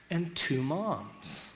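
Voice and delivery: ordinary delivery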